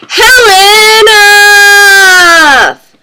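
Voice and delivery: high, long-drawn call; theatrical sign-off. Pretend. Drawn-out theatrical sign-off before howling.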